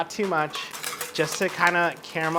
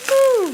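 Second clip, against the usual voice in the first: very high, loud woo; excited whoop; 7 dB louder than usual. Real, not acted. Cook delighted by the food, high loud whoop. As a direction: let out a sudden high whoop.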